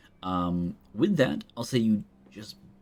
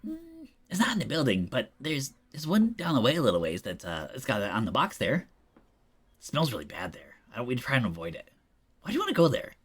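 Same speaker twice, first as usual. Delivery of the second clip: squeaky rat voice; 6 semitones higher than usual. Acted. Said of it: "one of them looks over and says".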